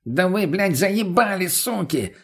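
furious high-pitched roar. Acted. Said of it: "взревел наркоман".

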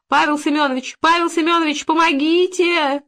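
high-pitched wail for rescue; calling for help. A narrator's reading. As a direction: call the neighbour desperately, stretching the plea. Trapped woman calls neighbour for help.

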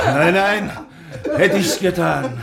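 mit Lachen in der Stimme, hoch; lachend. Acted. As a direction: die Frage lachend abwehren. Kapitän lacht über die Frage. (lachend, die Stimme höher)

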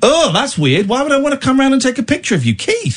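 mocking disbelief, pitch climbing; incredulous. Real, not acted. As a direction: scoff at the idea. Incredulous mocking reply to caller.

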